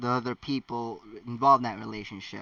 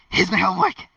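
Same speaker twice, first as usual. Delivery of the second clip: high, loud excited blurt; hammed-up eager schoolboy; eager shout; 7 semitones higher, 10 dB louder, faster than usual. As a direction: burst in shouting it. Harry bursts in, shoving homework.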